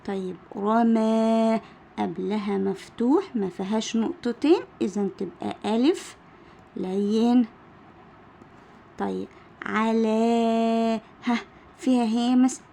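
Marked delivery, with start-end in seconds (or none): 0.6-1.6 رمى with a long held aa at the end; teacher's drawn-out رمى; elongated final vowel. Shown as a demonstration. Teacher sounding out example word. 9.6-11.0 على with the final aa held very long; teacher's drawn-out على; elongated final vowel. Shown as a demonstration. Teacher sounding out example word.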